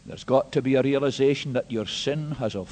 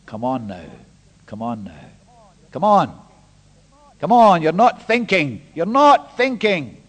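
building appeal; 6 dB louder than usual. Real, not acted. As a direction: push harder with every come on. Preacher pleading with congregation.

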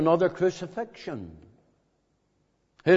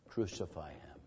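hushed crowd cry; 10 semitones lower, 15 dB quieter than usual. Retold or imitated. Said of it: "This is how they cried".

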